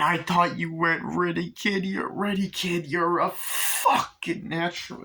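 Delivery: high, excited put-on praise; imitating proud Chucky. Chucky to Glenn after the kill, proud high voice.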